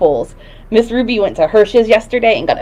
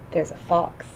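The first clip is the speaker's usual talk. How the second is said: soft hushed gasp, low; hushed surprise; 3 semitones lower, 11 dB quieter than usual. Real, not acted. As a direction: hush as if spotting a fox. Gasp then hushed fox sighting.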